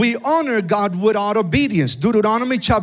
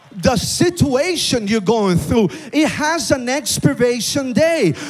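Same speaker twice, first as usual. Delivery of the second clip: preaching at full force; 5 semitones higher than usual. Acted. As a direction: build it up like a revival preacher.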